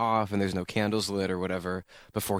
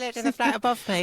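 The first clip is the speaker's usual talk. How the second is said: much higher, fast mock British accent; 14 semitones higher, faster than usual. Host teasing guest's high British accent. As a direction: squeak it up an octave like a Brit. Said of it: "Why is that your voice?".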